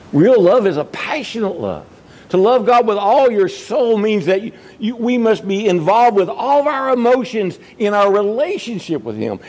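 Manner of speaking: high, rising preacher's appeal; preacher pleading with the congregation to keep their first love, fervent; fervent preaching. Acted. Preacher builds to passion.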